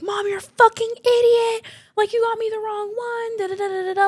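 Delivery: high-pitched imitation of a teen cussing out mom; mock tantrum. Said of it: "cussing at her mom".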